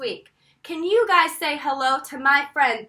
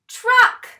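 truck called out high and loud; excited; 8 semitones higher, 8 dB louder than usual. Real, not acted. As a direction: truck announced with a big reveal. Teacher naming picture excitedly.

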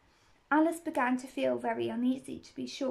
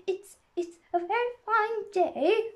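high, hesitant small-talk; timid; 9 semitones higher, 5 dB louder than usual. A narrator's reading. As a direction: offer it timidly, pitched up. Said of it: "said a timid voice".

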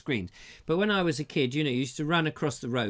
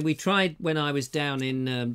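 normal talking voice

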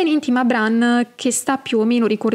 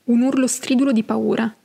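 plain reading voice

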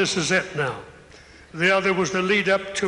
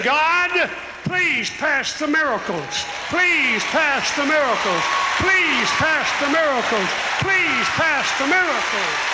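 higher, imploring repeated plea; pleading prayer; 6 semitones higher than usual. Retold or imitated. A sick person in a chair, pleading with God in a higher voice. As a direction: beg God again and again. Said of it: "somebody is up in a chair right now saying".

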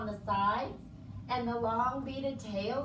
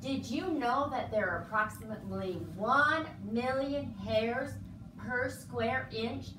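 ordinary delivery

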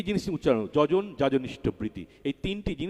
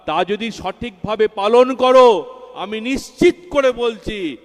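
much louder and higher, slow and insistent; climactic preaching; 8 semitones higher, 10 dB louder than usual. Real, not acted. Preacher promising the faithful their safety, loud and slow. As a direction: hammer the promise home, slowly.

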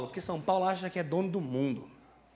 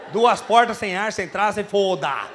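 slow, loud and hammered out; emphatic excitement; 2 semitones higher, 6 dB louder, slower than usual. Real, not acted. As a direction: stretch each word, fired up about the trip. Hyped retelling of the road trip. (contando a viagem, alto e arrastado, empolgado)

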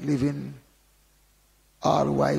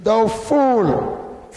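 high, loud cry of condemnation; sharp rebuke; 7 semitones higher, 7 dB louder than usual. Retold or imitated. Preacher voicing the Lord to the barn builder, sharp rebuke. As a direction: thunder it out as the Lord. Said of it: "The Lord said to him".

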